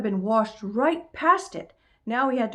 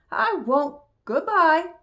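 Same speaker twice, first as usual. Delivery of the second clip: lightly voiced little boy, higher; high, louder child's farewell; 9 semitones higher, 5 dB louder, slower than usual. A narrator's reading. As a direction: pipe up like a small boy.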